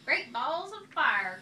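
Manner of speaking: loud slow goose cry; loud slow exclamation. A narrator's reading. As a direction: boom it out slowly. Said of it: "cried the goose".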